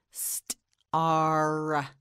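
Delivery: "Star" split as st, pause, long ar; sounded out. Shown as a demonstration. Teacher models the S word, "Star" sounded out slowly. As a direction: break "Star" after st and hold the ar. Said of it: "You say it".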